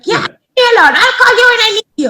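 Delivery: high, fast, laughing imitation; dismissive old lady. Old resident shooing the caregiver, high fast and laughing. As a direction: brush her off, laughing.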